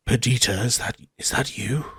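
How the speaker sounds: hushed and wary, checking no one hears; furtive hush. Acted. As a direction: keep it low, like someone might be listening. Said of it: "furtively looks around and says".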